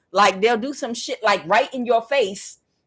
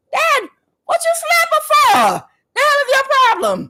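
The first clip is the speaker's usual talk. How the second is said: high, loud outraged yell; furious confrontation; 5 dB louder than usual. Retold or imitated. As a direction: re-enact screaming at dad. Retold mother yelling at father.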